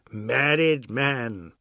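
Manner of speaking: very slow, drawn-out mocking call; drawn-out tease. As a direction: stretch out the taunt. Ajoda teasing Mohan again.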